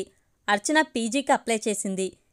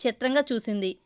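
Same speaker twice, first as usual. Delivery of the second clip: usual speaking voice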